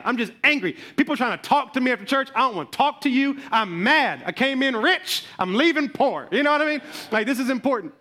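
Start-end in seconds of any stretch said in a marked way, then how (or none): none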